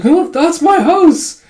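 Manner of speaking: high, loud acted exclamation; mock excitement. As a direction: draw out an excited discovery. Retold old man exclaiming.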